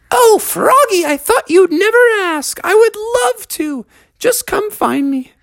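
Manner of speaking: put-on very high, gushing girl voice; high Beatrice voice. A narrator's reading. Beatrice accepting Froggy's skate invitation, very high and gushing. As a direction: squeal it out sweetly, very high.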